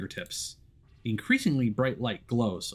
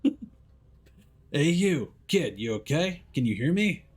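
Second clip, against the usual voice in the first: raised, higher calling voice; 8 semitones higher, 3 dB louder, slower than usual. Acted. Hero calling to the boy in the dark, raised voice. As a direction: call out to the hidden kid.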